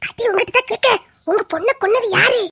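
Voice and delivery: ordinary talk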